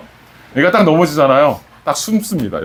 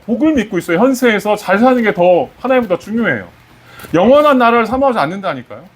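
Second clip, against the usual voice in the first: raised, insistent sermon voice; fervent preaching; 6 semitones higher than usual. Acted. Pastor pressing his flock about fear of death, fervent preaching. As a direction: press the point home to the congregation.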